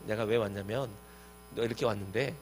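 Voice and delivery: low, soft mock excuse-making; imitating someone explaining himself, subdued; retold self-justifying. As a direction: mumble through excuses as if arguing back. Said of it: "막 따지지 않고".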